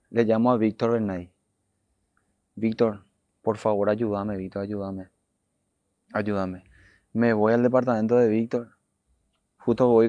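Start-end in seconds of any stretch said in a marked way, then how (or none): 2.5-6.7 low, fading plea for help; subdued imitation of desperate pleading; retold tearful plea. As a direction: beg a friend quietly, as if worn out from crying.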